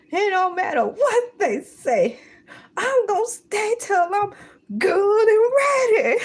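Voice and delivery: high, loud laughing declaration. Real, not acted. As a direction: cackle through the vow.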